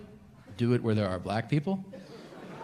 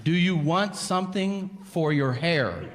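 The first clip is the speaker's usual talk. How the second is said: doctor repeating; 7 semitones higher, slower than usual. Retold or imitated. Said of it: "And he goes".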